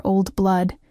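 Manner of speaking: ordinary delivery, nothing marked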